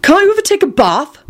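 high, indignant retort; affronted, hitting back; outraged. A narrator's reading. Neville telling Mundungus he stinks, outraged. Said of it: "Neville said in an outraged voice".

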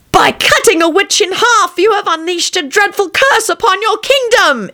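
high, ominous witch voice; cursing witch stump. A narrator's reading. Witch stump to the petrified king, high cursing voice. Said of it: "it told the petrified king".

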